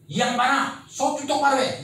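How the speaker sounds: hammed-up irritated student; mock angry complaint. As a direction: yell at the teacher to teach properly. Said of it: "이렇게 얘기했어".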